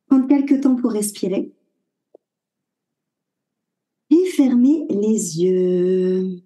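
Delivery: calm Yoga Nidra voice with long pauses; guided meditation. Acted. Guide leading a listener through body awareness before sleep, guided meditation. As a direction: guide the listener inward in short, unhurried phrases.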